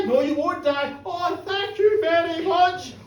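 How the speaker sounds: ordinary delivery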